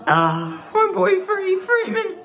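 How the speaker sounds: delighted shout for his favourite player; giddy fan laughing out a name; laughing cheer. Real, not acted.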